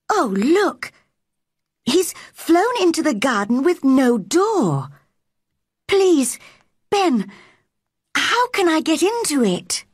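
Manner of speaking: put-on high, eager girl's voice rising to a plea; eager pleading. A narrator's reading. Mary begs the gardener to let her in, high and eager. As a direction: beg Ben, bright and urgent.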